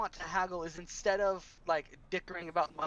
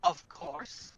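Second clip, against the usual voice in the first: a touch of offence, pitched up; slightly offended; 7 semitones higher than usual. Acted. Adventurer answering the innkeeper, slightly offended. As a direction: sound mildly insulted. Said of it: "I say, slightly offended".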